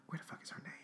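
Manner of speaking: voiceless, hushed muttering under the breath; quick private whisper, off to the side; whispered aside. Real, not acted. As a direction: whisper it to yourself while searching. Hunting for the name, aside.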